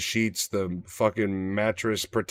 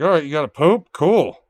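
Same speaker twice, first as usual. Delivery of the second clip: cheerful to dog; 5 semitones higher, 6 dB louder than usual. Pretend. Imagined talking to barking dog.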